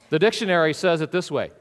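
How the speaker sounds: ordinary talk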